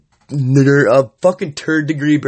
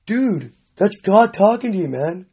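ordinary talk

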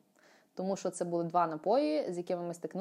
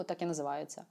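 usual speaking voice

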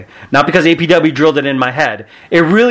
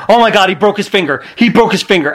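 fast, higher mock panic; freaking out; 5 semitones higher, faster than usual. Teammate bursts backstage with news, imitated freak-out. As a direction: rush in with imitated alarm. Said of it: "Daniel Bryan was freaking out".

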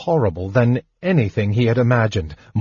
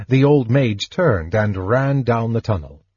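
ordinary delivery

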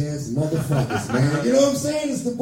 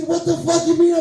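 mock her outrage, pitched way up; outraged woman; 11 semitones higher than usual. Comic imitating the angry date, high outraged voice.

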